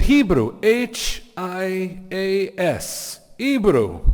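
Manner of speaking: put-on high Venezuelan accent, letters spelled out slowly; botched Venezuelan accent. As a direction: proclaim it loudly in a hammed-up Venezuelan accent. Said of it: "can't possibly do the accent right".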